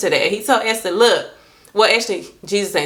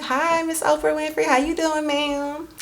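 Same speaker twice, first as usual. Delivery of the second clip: higher, softer, prim polite hello; polite fan greeting; 8 semitones higher, 4 dB quieter than usual. Pretend. Meeting Oprah, politely composed greeting, higher and softer. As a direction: greet her sweetly and properly. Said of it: "I'd be like".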